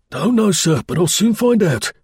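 high, urgent soldier's report; excited sergeant major. Acted. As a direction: blurt it out like a shaken NCO. Sergeant major answers officer.

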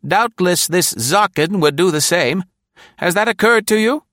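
high put-on woman's voice, loud and sharp; high, loud sassy secretary; tart secretary Nora. A narrator's reading. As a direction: tease the boss from the back seat.

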